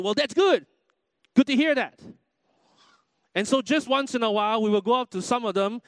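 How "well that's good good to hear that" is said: high, loud, hearty American small talk; American stranger. Retold or imitated. Preacher mimics the other guy answering, loud mock-American cheer. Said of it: "the other guy said".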